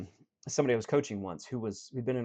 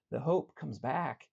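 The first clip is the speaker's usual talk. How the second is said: normal talking voice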